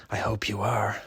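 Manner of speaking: hushed whisper to the listener. Pretend. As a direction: lean in and whisper it softly. Spooky aside to the listener.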